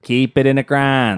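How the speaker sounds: broad Pittsburghese, "ground" flattened; Pittsburgh accent. Shown as a demonstration. Insisting over partner's complaints, broad Pittsburgh accent. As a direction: press on in a thick Pittsburgh accent. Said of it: "it's a terrible accent".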